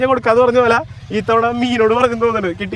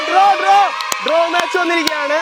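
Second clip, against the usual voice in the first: high, loud, excited call; excited announcement; 8 semitones higher, 4 dB louder than usual. Real, not acted. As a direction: announce the draw with a burst.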